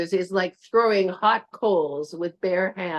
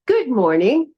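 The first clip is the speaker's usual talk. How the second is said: cheery, higher-pitched good morning; positive greeting; 6 semitones higher, 4 dB louder than usual. Retold or imitated. Yoga teacher re-enacting yesterday's greeting to her partner, very positive. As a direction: greet brightly, lilting up at the end. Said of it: "I set the tone for the day very positively".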